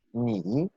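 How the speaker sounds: "ni" carefully drawn out on its own; tone demonstration. Shown as a demonstration. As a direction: model "ni" as an isolated tone. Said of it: "los tonos". ("ni" marcando el tono)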